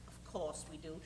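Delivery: hushed secret whisper. Acted. Wife confides plan to husband.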